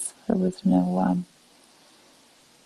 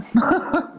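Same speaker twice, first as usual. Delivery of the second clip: brief, higher-pitched chuckle; short chuckle; 9 semitones higher than usual. Real, not acted. Amused at own remark to a viewer, short chuckle. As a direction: let out a quick little laugh.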